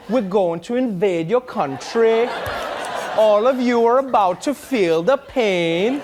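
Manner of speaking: put-on slow, lilting threat in Trinidadian accent; mock-menacing Trinidadian lilt; sing-song Trinidadian accent. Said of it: "it just doesn't work as an accent".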